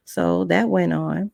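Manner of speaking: ordinary delivery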